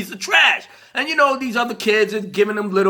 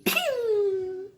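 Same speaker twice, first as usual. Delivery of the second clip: ordinary talk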